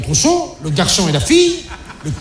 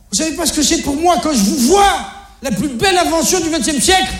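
question excédée; 7 semitones higher than usual. Acted. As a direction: question excédée, perchée dans l'aigu. Le père répète sa devinette, excédé. (question de père excédé, très aiguë)

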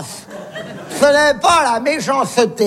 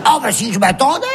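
normal talking voice

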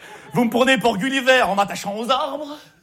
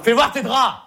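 sharp command; 5 dB louder than usual. Acted. Repeated louder command.

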